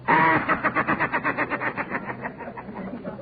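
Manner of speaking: high, soft, likable ghost chuckle; warm ghostly laugh. Acted. Announcer voices Edgar the ghost, now cheerful, warm likable laugh. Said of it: "a warm, likable laugh like this".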